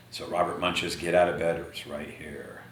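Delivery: whispered title. Real, not acted. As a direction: hush it like a secret. Whispered title before reading.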